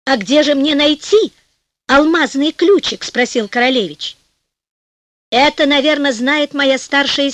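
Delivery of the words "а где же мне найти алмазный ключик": ordinary delivery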